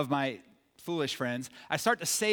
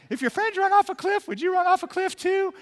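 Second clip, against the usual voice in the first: very high-pitched mock mother voice; hammed-up high voice imitating mom's question; bad mom impression; 13 semitones higher, 4 dB louder than usual. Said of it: "a bad impression of my mom".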